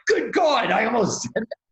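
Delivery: very high-pitched, aghast exclamation; hammed-up shock at your own near miss; mock horror. Acting out rereading the unsent email next day, horrified.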